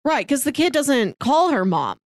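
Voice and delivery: ordinary talk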